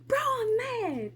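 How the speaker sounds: hammed-up pouty complaint to the coach; mock whiny protest.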